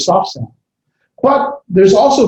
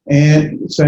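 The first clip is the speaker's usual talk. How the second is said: normal talking voice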